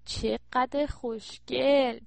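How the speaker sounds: very slow, drawn-out cooing; gushing admiration. Acted. Daughter admires the new phone, gushing admiration. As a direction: stretch it out admiringly.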